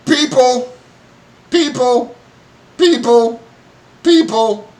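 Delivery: loud, high, repeated yells; yelling people. Acted. Goblin in the tree yells people at the arrivals, high. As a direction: keep hollering it from the tree. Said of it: "he just keeps yelling that".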